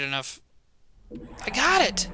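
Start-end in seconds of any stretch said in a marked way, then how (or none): 1.4-2.1 high triumphant yell; excited shout. Real, not acted. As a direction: shout it out in victory. Finally beats the hard part.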